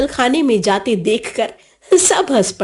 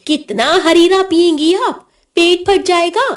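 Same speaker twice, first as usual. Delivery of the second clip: higher-pitched exasperated telling-off; indignant, raised-pitch reproach; mock scolding; 6 semitones higher than usual. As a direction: scold an elder like a bossy child. Boy scolds grandmother over eating.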